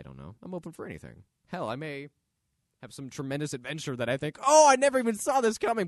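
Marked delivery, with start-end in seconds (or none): 4.5-5.9 high, loud mock astonishment; acted amazement. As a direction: exclaim it in hammed-up surprise. Acts out imagined surprised thought.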